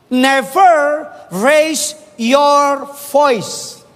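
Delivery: each word drawn out very slowly; slow emphatic English. Shown as a demonstration. Preacher to the congregation, very slow and deliberate. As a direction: spell the rule out word by word, very slow.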